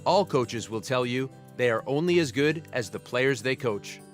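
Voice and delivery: ordinary talk, nothing marked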